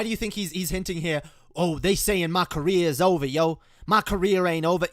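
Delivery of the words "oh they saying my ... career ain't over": mock [name] voice, louder and higher; imitating [name]. Host mocks [name] boasting to critics, louder and higher. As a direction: parody [name]'s brag.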